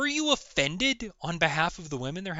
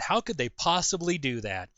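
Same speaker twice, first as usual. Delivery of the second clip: usual speaking voice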